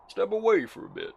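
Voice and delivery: put-on high, loud American-accented voice. Telling someone to step away, loud American accent. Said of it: "I don't know why I'm American now".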